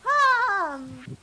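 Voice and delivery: hiccuping, high and loud; hiccups. Real, not acted. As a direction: talk through hiccups. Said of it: "it gives me hiccups".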